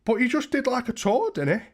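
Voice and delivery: high, loud mock disbelief, hammed up; fake shock. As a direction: act stunned for the chat, shrieking high. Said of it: "I'm acting".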